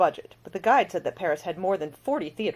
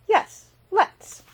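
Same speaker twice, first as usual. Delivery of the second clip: much higher and louder, bright; eager agreement; 8 dB louder than usual. A narrator's reading. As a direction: jump at the offer.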